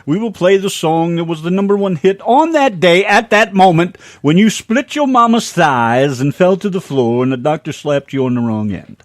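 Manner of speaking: loud, lifted host announcement; radio show intro. Acted. Host announcing birthday songs to the listeners, big show-intro voice. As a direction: punch it out like a radio DJ.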